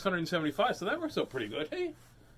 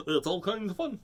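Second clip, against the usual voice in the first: a light goofy puppet voice; lightly goofy character voice; 2 semitones higher than usual. Acted. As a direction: say it like a silly cartoon puppet. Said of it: "It's almost like a goofy voice".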